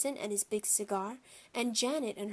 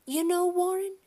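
high, louder motherly voice; pitched-up character voice for the mother; mother's voice; 9 semitones higher, 6 dB louder than usual. A narrator's reading. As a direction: voice a mom addressing her husband. Mother addresses father in story.

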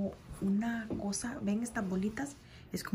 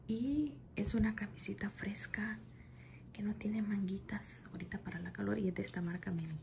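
hushed show-and-tell; ASMR-style whisper over new clothes; soft unboxing whisper; 5 dB quieter than usual. Acted. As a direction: whisper it close to the mic.

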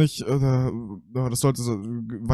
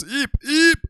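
sharp high call of the name; real alarmed call. Real, not acted. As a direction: call her name urgently. Calling to fallen companion.

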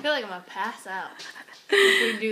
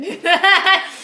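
laughing; 6 semitones higher, 10 dB louder than usual. Real, not acted. Laughing about lightheadedness.

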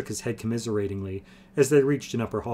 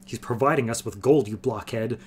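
ordinary delivery, nothing marked

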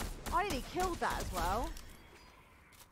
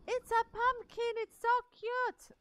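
excited squeal; 10 semitones higher, 7 dB louder than usual. Real, not acted. Gasps at a cute pumpkin.

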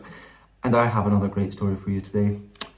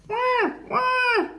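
very high, drawn-out wail; squeaky animal wah; baby seal cry. A narrator's reading. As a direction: bleat like a lost baby seal. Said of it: "she heard a cry".

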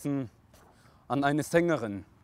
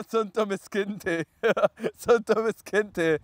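higher-pitched, through real laughter; laughing mockery; 5 semitones higher, 3 dB louder than usual. Real, not acted. Mocking the child in the poem, laughing. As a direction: giggle while teasing.

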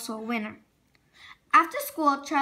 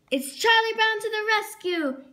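a higher, bold hero's announcement; heroic call; 6 semitones higher, 3 dB louder than usual. A narrator's reading. Charlie Brown runs on stage to rescue Sally, higher heroic cry. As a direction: charge in like a cartoon rescuer. Hero rushes to save Sally.

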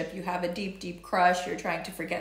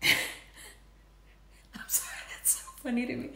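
giggly whisper; 3 semitones higher, 7 dB quieter, much faster than usual. Real, not acted.

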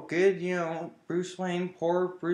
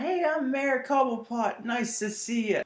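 high-pitched glad-handing politician; Mayor Cobblepot; 8 semitones higher than usual. Retold or imitated. Mayor greeting the public, high put-on friendliness. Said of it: "and he's in the public".

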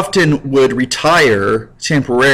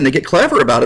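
normal talking voice

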